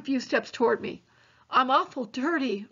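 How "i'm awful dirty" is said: ordinary delivery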